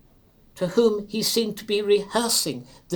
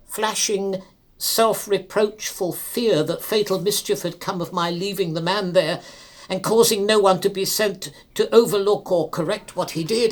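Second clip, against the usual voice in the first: usual speaking voice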